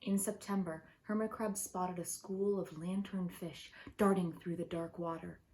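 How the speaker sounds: plain reading voice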